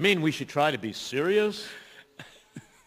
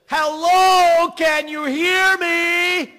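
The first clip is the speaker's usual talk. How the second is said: very loud, high, slow scream; 11 dB louder, much slower than usual. Retold or imitated. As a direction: bellow it drawn-out. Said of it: "still in screaming mode".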